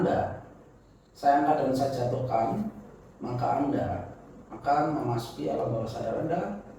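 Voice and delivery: soft, steady hypnotist voice; hypnotic suggestion. Acted. Hand drop suggestion.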